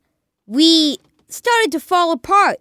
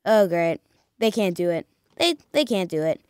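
low, soft resigned grumble; deflated fan; 8 semitones lower, 5 dB quieter, faster than usual. Retold or imitated. Fan after the Bears fail in overtime, deflated. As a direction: give up on them, voice dropped and quiet. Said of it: "And then you're like".

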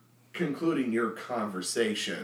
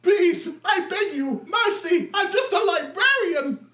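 much higher, louder pleading cry; begging deacon; 7 dB louder than usual. Acted. The deacon begs Minsk for mercy, high shouting plea. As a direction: beg for mercy in panic.

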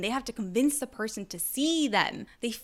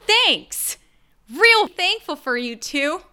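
loud, pointed mock gratitude; sarcastic thanks; 5 semitones higher, 9 dB louder, slower than usual. Speaker blaming Mom and Dad for attachment styles, sarcastic thanks. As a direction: thank them loudly, not meaning it.

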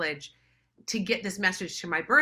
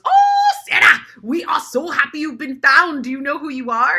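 much higher and louder, bursting with joy; overjoyed uncle; 8 semitones higher, 12 dB louder than usual. Retold or imitated. Uncle calls Sarah, overjoyed she's found, much higher and louder. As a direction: greet her like a long-lost treasure.

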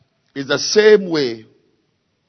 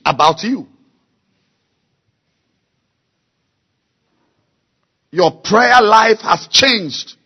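slow, loud, hammering sermon delivery; preaching at full force; 7 semitones higher, 8 dB louder, slower than usual. Acted. Preacher urging the church to change, full force. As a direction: drive every word home to the congregation.

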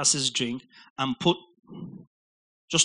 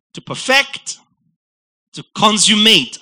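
building emphasis; 5 semitones higher, 8 dB louder than usual. Acted. Preacher building list to climax.

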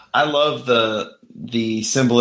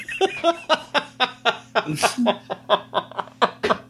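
big laugh; 13 semitones higher than usual. Real, not acted. Cracking up at the card.

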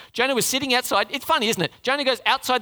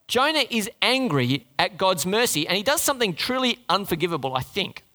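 ordinary talk

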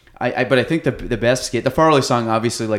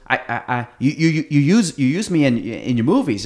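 imitating a stammer, repeating words; light mock stutter, like Bobby Boucher; stuttering lady; 3 semitones higher than usual. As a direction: stammer through it, tripping on every start. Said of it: "she starts stuttering".

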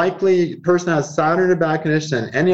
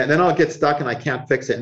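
usual speaking voice

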